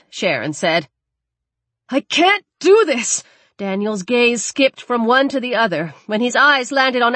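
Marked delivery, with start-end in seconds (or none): 1.9-3.2 anguished cry. Acted. Man forced to choose victim.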